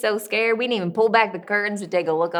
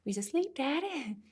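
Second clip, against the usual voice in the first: small, soft plea, rising high on the last word; timid child; 7 semitones higher, 8 dB quieter than usual. Acted. Daughter answering her raging father, timid and hushed. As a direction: shrink from him as you answer.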